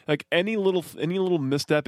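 ordinary talk

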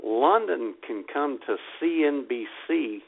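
normal talking voice

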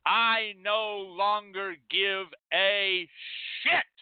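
slow rising rant that explodes on shit; building to a shout. Acted. Building outrage to shouted word.

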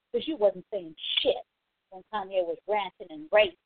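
usual speaking voice

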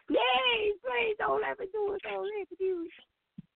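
high, far put-on whine begging please; a squeaky begging car near tears; crying car voice. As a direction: voice the old car pleading not to go on, like Sister Panther. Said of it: "I'm talking like Sister Panther".

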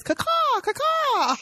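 high, screeching crow call; crow caw. Retold or imitated. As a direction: caw like a shrill bird. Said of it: "that one person that says".

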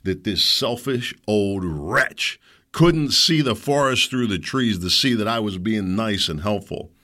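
ordinary talk

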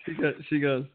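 usual speaking voice

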